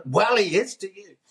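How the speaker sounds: high, whiny mock of rival reporters; jealous journalists. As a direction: squeak it out like envious reporters. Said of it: "all the other journalists would always go".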